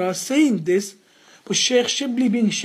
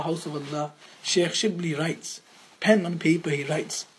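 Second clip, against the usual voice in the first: usual speaking voice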